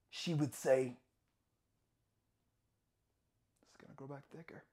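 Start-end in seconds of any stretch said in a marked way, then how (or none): 3.6-4.6 quick, very soft murmur of mother's warning; low, hushed quoted aside; hushed quote. Acted.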